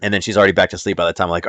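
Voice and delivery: ordinary talk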